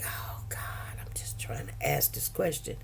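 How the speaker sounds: breathless whisper, no voice at all; whispered plea. Retold or imitated. To herself in front of the judge, a hushed whispered plea. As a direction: whisper it like a desperate aside nobody should hear.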